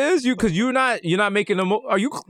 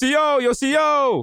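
calling out; 9 semitones higher than usual. Retold or imitated. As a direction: holler it down the cell block. Inmate calling the guard.

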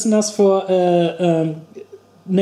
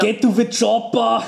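Arnold Schwarzenegger impression; 5 semitones higher than usual. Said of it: "some kind of Austrian".

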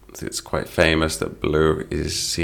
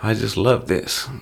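mock delight; 5 semitones higher, slower than usual. Shown as a demonstration. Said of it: "when we say".

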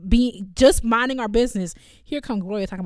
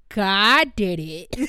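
slow deadpan excuse; 5 dB louder, much slower than usual. Retold or imitated.

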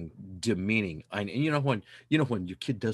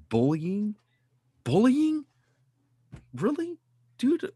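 incredulous; 11 semitones higher, 5 dB louder, slower than usual. Real, not acted. Incredulous about bullying.

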